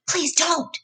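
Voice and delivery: high pleading voice; begging. A narrator's reading. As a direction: plead in a panic. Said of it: "he begged".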